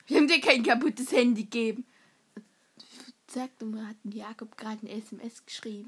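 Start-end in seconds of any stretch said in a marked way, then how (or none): 3.3-5.9 whispering. Retold or imitated. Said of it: "whispered gossip".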